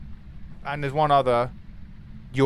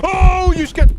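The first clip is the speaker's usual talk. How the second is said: high, loud scared yelp; panicked shriek; 12 dB louder, slower than usual. Real, not acted. Streamer caught by the ghost, panicked shriek.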